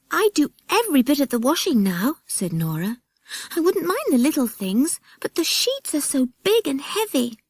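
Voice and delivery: put-on a tired small girl listing her chores; young girl Nora. Nora complains to the others, little-girl voice.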